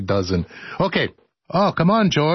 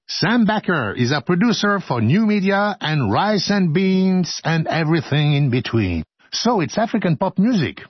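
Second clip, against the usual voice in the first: ordinary talk, nothing marked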